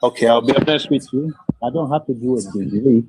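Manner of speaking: muffled, as if through a wall. Real, not acted. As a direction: voice muffled on a bad mic line. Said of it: "You sound muffled".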